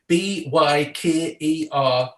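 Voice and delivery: Byker Grove, letter by letter, slow and clear; spelled out slowly. Shown as a demonstration. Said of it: "where is the show called?".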